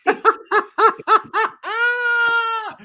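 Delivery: high, squealing belly laugh; real cackling fit of laughter; helpless laughter. Real, not acted. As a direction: burst into long, high laughter. Cracking up at the accent.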